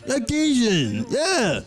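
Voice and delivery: put-on high, stretched-out Cajun accent; unconvincing, hammy Cajun caller. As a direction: insist in a badly faked Cajun accent. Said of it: "I don't think Cajun accents sound like that".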